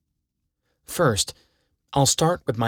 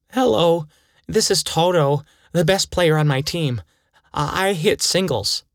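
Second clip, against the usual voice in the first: 1 eager phone greeting; 5 semitones higher than usual. Acted. Eager answer to the scout.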